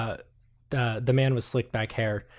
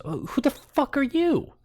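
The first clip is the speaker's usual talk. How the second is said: much higher, surprised NPC voice; high shrill-free put-on voice; 13 semitones higher than usual. Journalist rudely demanding who she is, much higher voice. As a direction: pitch way up, affronted.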